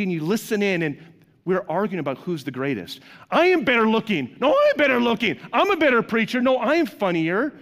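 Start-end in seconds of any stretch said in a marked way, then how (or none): 3.3-7.5 mock bickering. Pastors acting petty argument.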